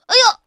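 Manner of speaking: high, loud ouch cry; loud yelp of pain. Acted. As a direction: yelp as you trip and fall. Kangaroo trips on a stone.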